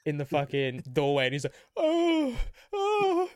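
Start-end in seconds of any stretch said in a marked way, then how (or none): 1.8-3.3 very high loud mock moans; strained moaning. Imitating the frozen brother in the doorway, high loud moans. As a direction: whimper high like you are frozen. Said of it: "he went".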